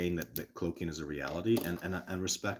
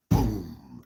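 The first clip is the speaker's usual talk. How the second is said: a sudden, louder "boom" like an impact; booming imitation; 3 semitones lower, 8 dB louder than usual. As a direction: drop the word like a hit.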